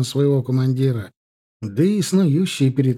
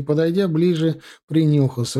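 usual speaking voice

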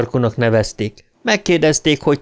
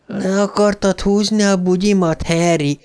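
higher-pitched coquettish tease; teasing; 8 semitones higher than usual. A narrator's reading. A girl's ghost voice needling Harry, teasing. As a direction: tease him in a lifted voice. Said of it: "incselkedett egy újabb hang".

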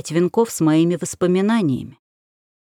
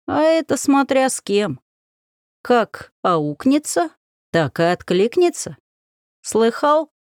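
старушечий голос; 6 semitones higher, slower than usual. Acted. Баба Яга поучает мальчика.

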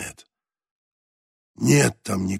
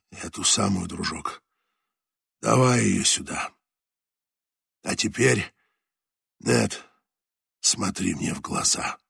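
put-on low, soft, fading old sailor; dying skipper; 4 semitones lower, 4 dB quieter than usual. A narrator's reading. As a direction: speak like a dying man holding on. Voices dying skipper Leclerc.

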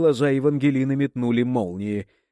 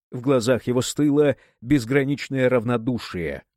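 usual speaking voice

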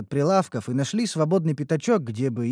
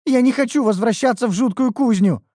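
put-on high loud indignant elf girl; young elf girl refusing to go back; declaring; 10 semitones higher, 6 dB louder than usual. A narrator's reading. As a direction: stamp her foot in protest. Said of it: "заявила Неуру".

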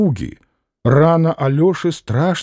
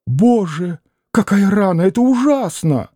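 rising, higher exclamation of fright; alarmed cry; 6 semitones higher than usual. A narrator's reading. Mother seeing Alyosha's wound, shocked and fearful. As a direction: gasp at the bloody wound. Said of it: "вскрикнула".

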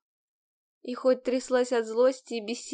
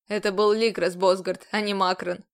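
stern commander; 4 semitones lower, 3 dB louder than usual. A narrator's reading. Commander role.

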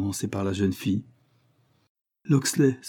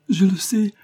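high, loud crying protest; weeping daughter, high and loud; tearful insistence; 12 semitones higher, 7 dB louder than usual. A narrator's reading. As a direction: she bursts into tears as she insists. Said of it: "en versant d'abondantes larmes".

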